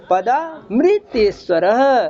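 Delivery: "पदामृतेश्वरः" as padaa, mree-teshvarah; slow chant, clear ri after the m in "पदामृतेश्वरः"; ri-sound reading. Shown as a demonstration. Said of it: "तो उसको पढ़ेंगे". ("पदामृतेश्वरः" में मृ को म्री, धीमा पाठ)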